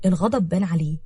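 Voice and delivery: ordinary delivery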